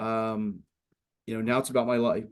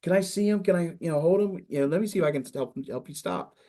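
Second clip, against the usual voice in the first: put-on much higher, eager cooing over a baby; eager new dad; 7 semitones higher than usual. Now a father, he coos at a crying stranger's baby, eager and high. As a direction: gush like a parent who loves babies. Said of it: "I'm like".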